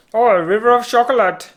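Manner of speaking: put-on high, loud German kid voice; German child accent. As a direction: gush it like a greedy German boy. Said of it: "What kind of accent was that?".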